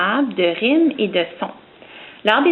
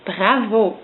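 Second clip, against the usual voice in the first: ordinary talk, nothing marked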